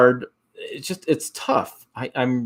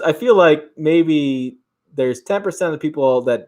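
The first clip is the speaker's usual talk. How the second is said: ordinary talk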